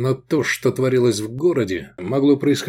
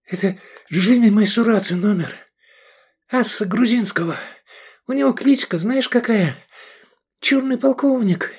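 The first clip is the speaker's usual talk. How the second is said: much higher, breathless on the run; while running; 10 semitones higher than usual. A narrator's reading. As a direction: talk while running under fire. Edik talks while running.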